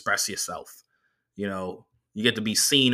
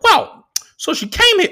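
much higher, louder nerdy driver voice; the driver's voice; 8 dB louder than usual. Retold or imitated. Comedian doing the driver's voice for the crowd, high and squeaky.

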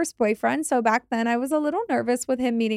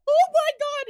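shocked. Real, not acted. Reacting to demon in story.

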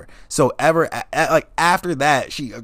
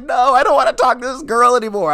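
exasperated; 12 semitones higher, 6 dB louder than usual. Retold or imitated. Exasperated rant.

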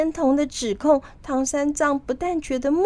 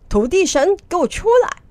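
loud, high-pitched command; shouted summons; 8 semitones higher, 5 dB louder than usual. A narrator's reading. Monkey King summoning the earth god, shouting. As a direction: call out sharply for someone to appear. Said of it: "他就大叫".

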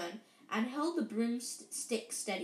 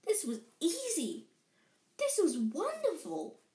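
high, thrilled rush of joy; exhilarated; 6 semitones higher than usual. A narrator's reading. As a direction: marvel at a newfound gift. Harry's joy at flying.

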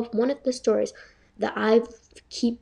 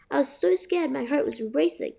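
ordinary delivery

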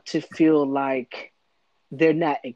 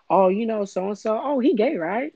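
mocking gossip voice; 10 semitones higher, 5 dB louder than usual. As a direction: toss it off like a gossiping neighbour.

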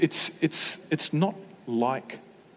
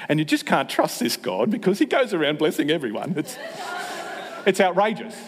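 mock outrage; 6 semitones higher than usual. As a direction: play up fake outrage at God's generosity. Mock complaint about God blessing everyone.

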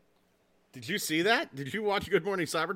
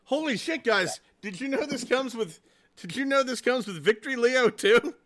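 high, amazed exclamation; surprised; 8 semitones higher than usual. Real, not acted. Amazed as he tells the hosts of a bonus toy. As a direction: gasp it out in disbelief.

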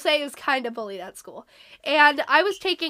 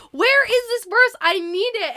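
frantic; 9 semitones higher, 9 dB louder than usual. Retold or imitated. Frantically searching for lost verse.

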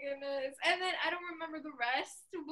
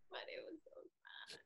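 soft whisper, much quieter; whispered sadness; 6 semitones higher, 17 dB quieter than usual. Real, not acted. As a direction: let it fall to a sad whisper. Sad goodbye memory, voice drops.

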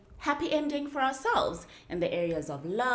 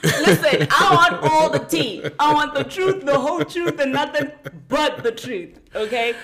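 mock courtroom demand; 5 semitones higher, 5 dB louder than usual. Demands the full story, laughing.

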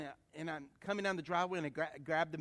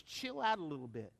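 ordinary talk

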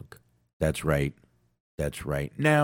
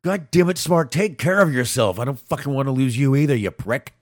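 louder, higher mock-angry rant; loud fond telling-off to the sick friend; affectionate scolding; 6 semitones higher, 5 dB louder than usual. Real, not acted. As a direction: chew him out because you care.

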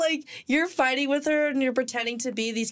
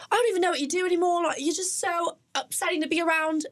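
higher, fast mock British couple-fight voice; mock British row; 8 semitones higher than usual. A fake British couple arguing in the taxi, higher and fast. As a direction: snap at her in a put-on British accent, fast. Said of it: "we were also pretending to be British".

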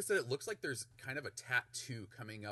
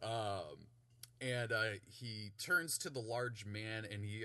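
ordinary delivery

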